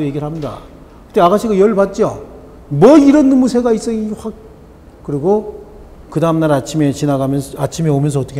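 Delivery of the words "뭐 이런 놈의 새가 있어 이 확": higher, louder outraged cry; indignant woman. Acted. Insulted woman shouting at the parrot, outraged. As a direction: blow up at the rude bird. Angry woman snaps at bird.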